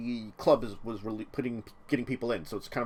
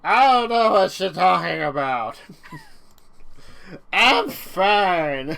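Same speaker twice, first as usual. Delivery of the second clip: put-on drunk denial; 6 semitones higher, 7 dB louder, slower than usual. Voicing drunk character denying it.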